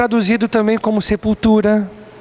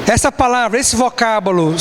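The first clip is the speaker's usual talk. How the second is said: ordinary talk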